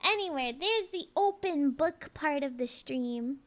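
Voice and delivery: normal talking voice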